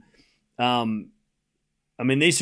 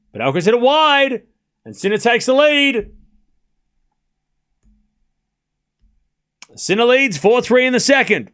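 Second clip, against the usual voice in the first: higher and louder, calling the lead; excited call; 9 semitones higher, 5 dB louder than usual. Real, not acted. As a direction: lift the voice as the point ends. Sinner takes lead, excited call.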